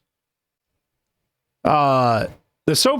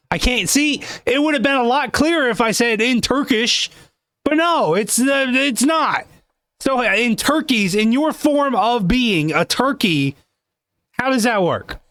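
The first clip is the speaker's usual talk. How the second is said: a touch higher, incredulous questioning host; lightly voiced high interviewer; 9 semitones higher than usual. Interviewer quizzing the guest about being Turkish, lightly raised voice. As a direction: play the puzzled host prodding the guest.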